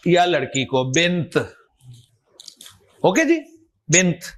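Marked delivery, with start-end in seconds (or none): 0.8-1.4 بنت with the closing t clearly released; final t sounded. Shown as a demonstration. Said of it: "تا کی آواز سنائی دے رہی ہے". 3.9-4.2 بنت ending in a crisp, audible t; teacher's demo of بنت with its last t voiced out; final t sounded. Shown as a demonstration. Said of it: "تا کی آواز سنائی دے رہی ہے".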